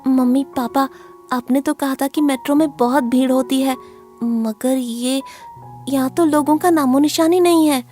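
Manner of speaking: put-on high-pitched, uneasy child voice; nervous boy. A narrator's reading. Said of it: "Kunal ये देखकर थोड़ा घबरा जाता है". (घबराए हुए बच्चे की ऊँची आवाज़)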